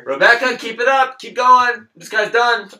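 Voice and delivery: loud, high holler to a coworker; clerk yelling out. Acted. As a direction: shout instructions over the customer's head.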